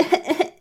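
high fake sobs; quick imitated boo-hoo bursts; mock sobbing. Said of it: "she's like".

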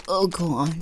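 ordinary talk

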